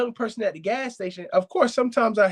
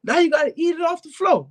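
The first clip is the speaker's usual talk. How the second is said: replaying how he scolded Ghoulie, pitched way up; retold angry yell; 11 semitones higher than usual. Owner scolding dog for knocking over food bowl, high mock yell. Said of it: "I yelled at him like".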